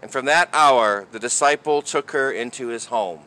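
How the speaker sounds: solemn proclamation read aloud; gospel reading. Real, not acted.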